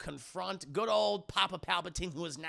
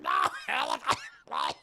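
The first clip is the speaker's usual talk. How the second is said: low strangled voice. Retold or imitated. Padme choked, acted.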